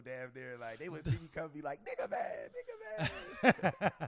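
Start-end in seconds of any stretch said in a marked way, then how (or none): 1.8-4.1 high, loud playful call, laughing at the end; excited calling out. Retold or imitated. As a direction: shout it out like kids greeting a hero. Kids calling him out on street.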